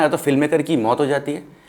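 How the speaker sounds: ordinary talk, nothing marked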